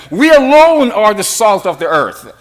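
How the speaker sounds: "alone" pushed out, falling after; single out alone with weight; stress on ALONE. Shown as a demonstration. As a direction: hit "alone" as the point. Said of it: "And it's emphatic".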